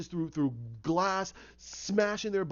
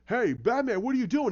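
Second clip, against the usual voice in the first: raised mock call; higher, urgent retold voice; imitated alarmed challenge; 5 semitones higher, 4 dB louder than usual. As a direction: question like a startled policeman. Gordon challenges Batman in alarm.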